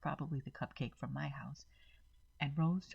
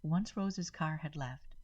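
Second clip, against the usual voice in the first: plain reading voice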